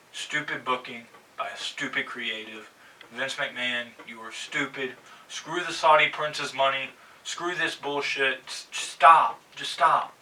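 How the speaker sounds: quiet seething rant, low and soft; low, bitter grumble at the boss; low muttered anger. Real, not acted. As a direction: seethe it under your breath. Ranting at Vince McMahon.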